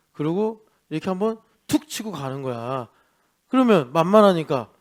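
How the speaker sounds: ordinary delivery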